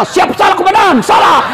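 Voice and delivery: loud, high barked yes-sir replies; mock policeman shouting siap salah; scolded policeman. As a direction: snap out apologies like a cowed officer.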